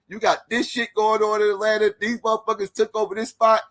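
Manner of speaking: high-pitched mock voice of the debater; squeaky caricature of a ranting guy; mimicking Rio. Moderator mimics Rio's funny rebuttal line in a high voice. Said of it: "that motherfucker was like".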